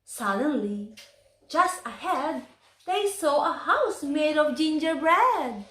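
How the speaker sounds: plain reading voice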